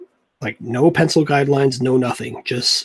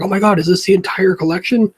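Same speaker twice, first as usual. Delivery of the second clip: quoted gasp of excitement, higher and louder; excited friend; 7 semitones higher, 5 dB louder than usual. Retold or imitated. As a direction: relive the friend's thrill at the find. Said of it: "He's like".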